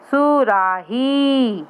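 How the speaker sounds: सुराही stretched very slowly, each syllable long; slow sounding out. Shown as a demonstration. Teacher to young pupils, saying सुराही slowly. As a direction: draw out सुराही for beginners. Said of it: "teacher sounds out word".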